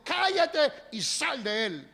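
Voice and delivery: slow, loud, high commanding rebuke; stern rebuke. Acted. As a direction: order the demon out. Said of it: "Le dijo". (reprensión firme y lenta)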